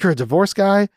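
high-pitched mock disbelief; retold astonished question, voice jumping up; incredulous question. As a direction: act out a man taken aback. Clooney learns of divorce investigator.

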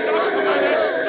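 loud, slow summons across the courtroom; summoning call. Acted. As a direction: call the witness forward. Judge summons witness.

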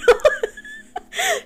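shrill burst of laughter; high squealing laugh. Real, not acted. Big laugh.

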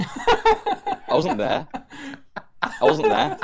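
high, laughing protest. Real, not acted. Giggling denial to friends who asked why. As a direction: squeal it out through laughter.

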